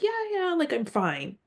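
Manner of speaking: high, brushing-off "I'm fine"; retold pretend-fine. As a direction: act out insisting you're okay when you aren't. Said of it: "when I really wasn't fine".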